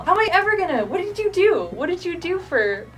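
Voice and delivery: incredulous protest. Real, not acted. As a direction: demand an answer in exasperated disbelief. Protests at colleague's unbeatable score.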